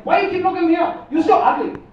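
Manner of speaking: high, loud mock Chinese accent; a rude GPS voice in a put-on Chinese accent, pitched high. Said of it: "It's like".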